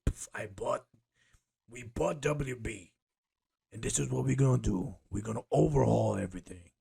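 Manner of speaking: a hint of a brash boss laying down plans; lightly put-on chief executive; CEO voice. New CEO announcing the overhaul, in a lightly put-on boss voice. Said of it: "that was my C E O voice".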